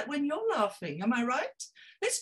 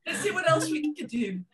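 laughing; 3 semitones higher, 4 dB louder than usual. Real, not acted. Laughter yoga session peak.

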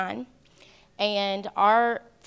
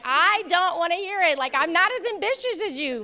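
high, loud and laughing as she mocks the brushing-off; mock exasperated brush-off; 9 semitones higher, 3 dB louder than usual. Best friend teasing the groom about his schemes, laughing brush-off. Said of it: "And I'm like".

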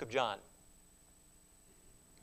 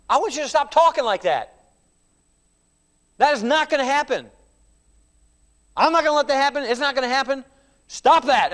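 higher and louder, pleading-insistent; insistent rebuke; 6 semitones higher, 6 dB louder than usual. Acted. Peter insisting to Jesus, higher and louder. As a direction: shout him down, you won't let it happen.

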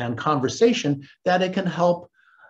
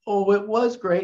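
higher-pitched imitation of someone starting to cry; put-on weepy voice; mock tearful; 6 semitones higher than usual. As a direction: pretend the tears are coming. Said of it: "And she starts crying".

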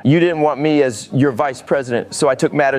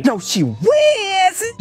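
very high, slow wail; tearful denial; much slower than usual. Acted. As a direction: squeal it out, stretched with grief. Defending his ex in tears.

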